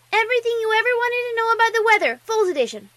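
put-on high, loud, rushed child boast; eager squeaky kid voice; proud little filly. A narrator's reading. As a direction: rattle it off proudly like a small bookworm. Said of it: "she said proudly".

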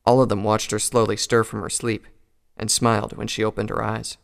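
plain reading voice

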